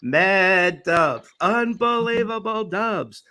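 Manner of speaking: drawn-out boastful catchphrase; slow bragging host. Retold or imitated. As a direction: stretch every word, boasting. Host's bragging catchphrase mimicked.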